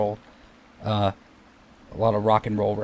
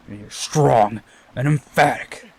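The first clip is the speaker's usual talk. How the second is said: very slow, louder, every word weighted; strong and emphatic; 5 dB louder, much slower than usual. Shown as a demonstration. Gamer praising the word, slow and louder. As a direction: declaim it slowly like a proud pronouncement. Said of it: "Like, emphatic".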